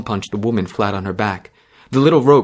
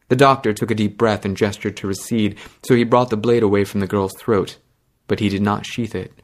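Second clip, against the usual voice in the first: plain reading voice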